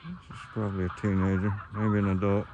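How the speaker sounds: talking through a face mask; muffled speech behind a mask. Real, not acted. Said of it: "Sorry, I'm muffled. I got a mask on".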